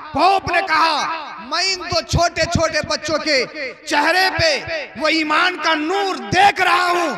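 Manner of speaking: high, loud, slow declamation building to shouts; full-force preaching. Acted. Preacher voicing the pope's dread of the children, full-force preaching. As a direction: thunder the warning out in measured bursts.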